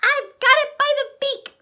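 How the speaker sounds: very high, loud cub cry. Acted. Baby Bear, digging through the pillows, cries out very high. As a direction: shout the find like a tiny cub. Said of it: "Baby Bear cried".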